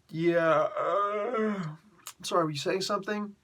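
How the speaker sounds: teasing Ali tuning out the champion; dismissive. Retold or imitated. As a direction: act like you weren't listening. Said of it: "he's just like".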